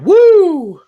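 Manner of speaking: high loud Ric Flair woo; wrestling whoop. Pretend.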